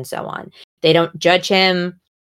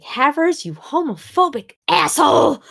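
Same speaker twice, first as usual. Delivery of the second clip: mad outburst; 12 semitones higher than usual. Retold or imitated. Fan furious at Havers for his homophobia, high indignant yell. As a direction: hurl the insult in outraged disbelief. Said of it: "I was just, like, so mad".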